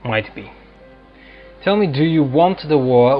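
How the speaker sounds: ordinary talk, nothing marked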